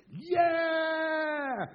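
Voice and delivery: long, high roar of "yeah"; celebrating fan's shout. Retold or imitated. Son at the doorway celebrating a goal, jubilant shout. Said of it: "shouts two things, two phrases".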